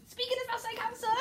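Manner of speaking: very high, hurried squeak; rapid burst. Shown as a demonstration. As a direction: race through it in a high voice. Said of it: "then he just goes".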